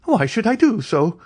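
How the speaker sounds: high incredulous scoff. Acted. Andrea answering Eugénie, high scoff. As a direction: scoff at the idea.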